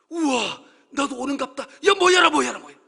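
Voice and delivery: reenacted thrilled holler; excited calling out. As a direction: marvel loudly, then yell for them to gather. Acting out amazement, calling fish.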